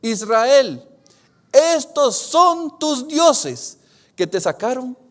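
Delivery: slow, loud announcing cry; loud proclamation. Acted. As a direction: proclaim it to the crowd, slowly. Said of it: "Entonces dijeron".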